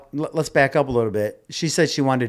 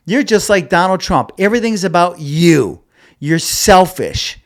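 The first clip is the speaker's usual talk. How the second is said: louder, higher, an accusing rant; angry accusation; 5 semitones higher, 6 dB louder than usual. Retold or imitated. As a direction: tear into him, fed up and accusing. Her angry text acted out.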